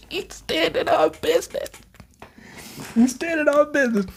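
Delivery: high, excited hype; hyped. Real, not acted. Reacting to Sukuna, high hyped squeal. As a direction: squeal it out in disbelief.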